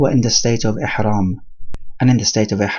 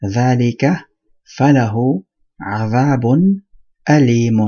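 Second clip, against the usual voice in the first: Quran recitation. Acted. Teacher recites the ayah to his students in melodic tajweed. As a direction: chant the verse the way a reciter would.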